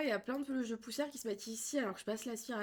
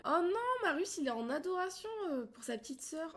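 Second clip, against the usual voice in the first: delighted gush; 7 semitones higher than usual. Real, not acted. Sim adores his little sister.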